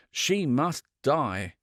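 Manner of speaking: plain reading voice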